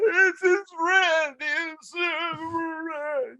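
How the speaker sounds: put-on high, slow, sobbing child's wail; imitating crying toddler. Toddler sobbing to parents about his friend, crying. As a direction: blubber it out through tears like a little boy. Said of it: "hard sobbing".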